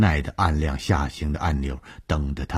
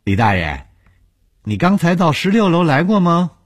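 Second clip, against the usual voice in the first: high, loud character question; pointed, high-pitched inquiry; young colleague asking; 13 semitones higher, 8 dB louder than usual. A narrator's reading. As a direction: call out to the old man, pitch raised. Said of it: "宋晴聪明地问".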